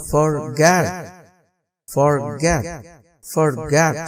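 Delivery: forget with schwa in for, stress on get; short "fer", strong get in forget; end-stressed forget with weak for; reduced first syllable. Shown as a demonstration. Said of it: "अब ये होगा".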